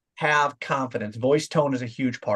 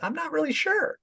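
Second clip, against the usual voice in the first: much higher, soft and hesitant; unsure voice; 12 semitones higher, 4 dB quieter than usual. Shown as a demonstration. Coach demoing how unsure speech rises at the end. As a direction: let it float up like a question. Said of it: "goes up anytime you're unsure".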